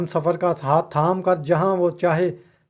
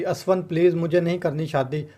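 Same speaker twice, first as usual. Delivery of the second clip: usual speaking voice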